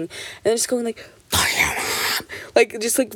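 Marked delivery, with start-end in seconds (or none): 1.3-2.3 mock-defiant whispered outburst; furious secret whisper. Kid behind a slowly closed door, defiant whisper. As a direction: whisper the curse, drawn out, so nobody hears. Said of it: "really quietly, just, like, this whisper".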